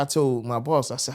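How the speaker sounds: usual speaking voice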